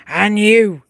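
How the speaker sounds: a loud call across the stable yard; booming voice. A narrator's reading. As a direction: boom it out like a sergeant. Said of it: "came that booming voice again".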